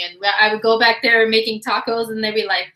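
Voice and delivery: normal talking voice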